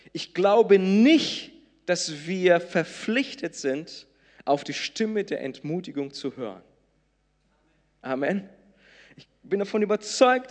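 loud, insistent pulpit voice; fervent sermon delivery; preaching. Acted. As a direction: press the point home to the church. Preaching at full force.

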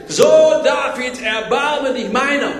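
high, loud, drawn-out plea; imitated desperate cry. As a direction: cry out slowly like a beggar pleading. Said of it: "fängt der Mann am Straßenrand an zu schreien".

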